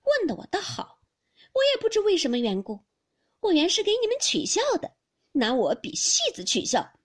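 loud, high, wounded indignation; cold scoff. A narrator's reading. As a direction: throw the question back with bitter scorn. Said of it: "林黛玉冷笑道". (冷笑着高声反诘)

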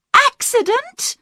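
high, startled echo of the word; shocked cry. A narrator's reading. Daughter to inspector, shocked high cry. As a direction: Diane seizes on the word in disbelief. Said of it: "Diane cried".

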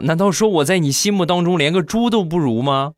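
high, mock-hurt disbelief; plead, hammed-up betrayal; wounded son. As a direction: son aghast at losing to a pig. Son hurt, compared to pig.

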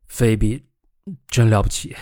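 ordinary delivery

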